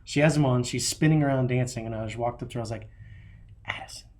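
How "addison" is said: put-on soft bedtime whisper to a child; hushed, voiceless coaxing. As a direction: lean in close and whisper it gently. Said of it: "get in real close and whisper".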